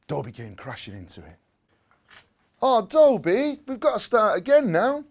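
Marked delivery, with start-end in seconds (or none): 2.6-5.0 put-on child's voice. A narrator's reading. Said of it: "said Jimmy".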